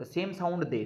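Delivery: ordinary talk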